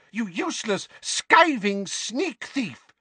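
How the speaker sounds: high, drawn-out insults; furious scolding. A narrator's reading. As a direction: berate the culprit in a high voice. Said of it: "cried Missus Figg".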